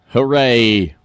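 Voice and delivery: unenthusiastic, understated hooray; deadpan cheer. Pretend. Host back to the political chaos, deadpan mock cheer. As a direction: cheer with no lift at all. Sarcastic cheer before bad news.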